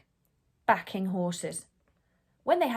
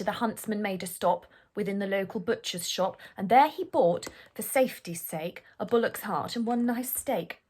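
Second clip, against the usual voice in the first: ordinary delivery, nothing marked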